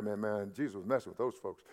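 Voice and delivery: quick, offhand street-talk aside; casual vernacular. Shown as a demonstration. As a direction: toss it off like everyday slang. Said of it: "That's how we would say it".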